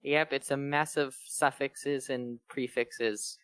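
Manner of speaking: ordinary talk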